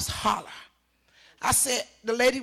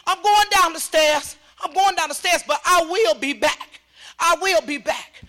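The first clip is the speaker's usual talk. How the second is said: loud, raised, emphatic retold promise; defiant vow; 5 semitones higher, 5 dB louder than usual. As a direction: declare it firmly on your way out. Defiant parting words to officer.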